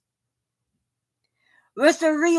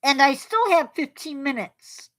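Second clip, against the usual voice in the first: through a face mask. Real, not acted. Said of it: "Try talking through a mask".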